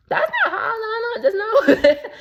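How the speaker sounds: high laughing squeal of refusal; squealing no through laughter. Real, not acted. Rejecting freeloaders, laughing.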